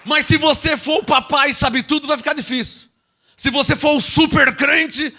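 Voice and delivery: high, loud sung preaching; full-force preaching. Acted. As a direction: preach it with rising fervour.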